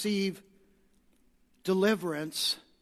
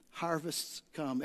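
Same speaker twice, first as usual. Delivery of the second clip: soft, slow line; 3 semitones lower, 8 dB quieter, slower than usual. Shown as a demonstration. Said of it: "Everybody say".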